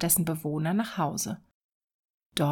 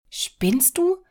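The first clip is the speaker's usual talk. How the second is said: much higher, louder incredulous scoff; indignant wife; 9 semitones higher, 5 dB louder than usual. Acted. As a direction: scoff at the husband as if he's lost it. Wife scoffs at husband's wish. (empört und ungläubig)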